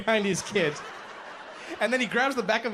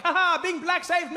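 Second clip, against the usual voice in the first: gleeful escape; 9 semitones higher than usual. Retold or imitated. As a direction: crow about getting away. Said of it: "I was like".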